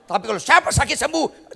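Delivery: ordinary talk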